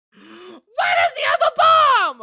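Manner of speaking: very high, drawn-out shout; incredulous shriek. Real, not acted. Player sees bomb on the stand, incredulous shriek. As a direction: scream the question slowly in disbelief.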